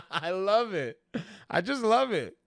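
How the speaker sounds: gleeful, can't stop grinning; amused delight. Real, not acted. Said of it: "I don't mean to laugh".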